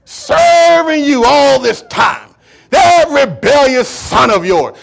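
slow, drawn-out indignant protest; angry brother. Acted. Jealous brother complaining to his father, angry and aggrieved. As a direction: wail the grievance, stretching the key words. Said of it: "angry about this thing".

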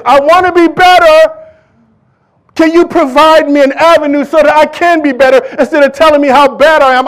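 sinner's plea, preached high and loud; pleading sinner. Acted. Preacher voicing a sinner begging the church for help, loud plea. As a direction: cry out the sinner's plea in full voice.

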